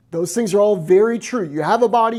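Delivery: ordinary delivery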